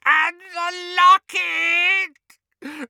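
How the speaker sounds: very high, drawn-out, loud elf wail; blood-curdling scream. A narrator's reading. House elf breaking down over the stolen locket, screaming. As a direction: scream it out in anguish, stretching the words. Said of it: "he uttered a blood-curdling scream".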